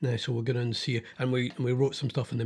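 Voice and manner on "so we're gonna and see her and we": ordinary talk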